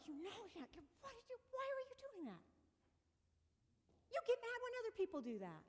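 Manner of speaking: high, fast scolding voice; scolding sister. Retold or imitated. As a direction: imitate her sister's nagging. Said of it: "she was mad at me for doing it".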